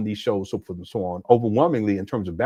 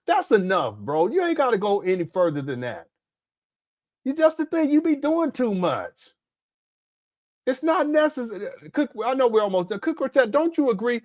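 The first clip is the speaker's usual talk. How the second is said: exasperated scolding; 11 semitones higher than usual. Real, not acted. Scolding Umar, still worked up.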